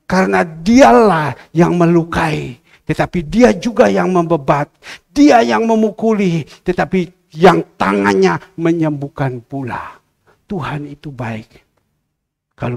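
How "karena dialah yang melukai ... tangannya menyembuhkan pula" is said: loud, high, forceful Bible proclamation; slow, ringing preacher's declamation; preached scripture reading. Acted. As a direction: belt the verse out to the congregation. Reads scripture at full force.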